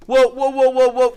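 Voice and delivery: high, loud whoa whoa; mock alarm. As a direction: cry out in pretend alarm.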